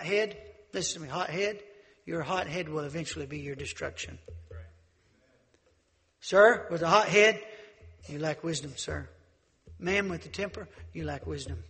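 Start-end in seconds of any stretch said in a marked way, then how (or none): 6.2-7.4 preaching shout. Real, not acted. Preacher calling out a hot-headed man, shouting. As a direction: call him out from the pulpit.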